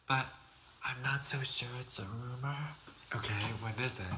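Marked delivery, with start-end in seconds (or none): none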